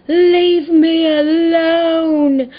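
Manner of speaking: loud, very high mock wail, every word stretched; making a scene. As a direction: imitate a hysterical neighbour playing the victim. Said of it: "making a scene".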